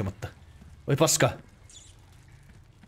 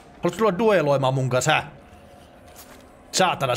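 normal talking voice

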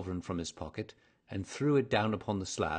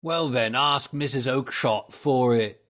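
loud, raised trader's rebuff; angry retort; 5 semitones higher, 11 dB louder than usual. A narrator's reading. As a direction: send the pest off elsewhere. Salesman angrily rebuffs the inquirer.

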